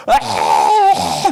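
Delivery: loud mock bawling outburst; imitated wail. Preacher showing how they cried alone praying, imitated loud wailing. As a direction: wail out loud as if sobbing in prayer. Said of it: "막 이렇게 울었습니다".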